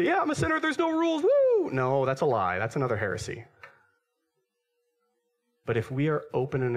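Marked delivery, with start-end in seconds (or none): 0.0-1.7 squealing high party voice, whooping; mock carefree sinner. Preacher mocking a sinner who says anything goes, high and whooping. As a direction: ham up a reckless partier with a woo. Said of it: "He shouldn't say".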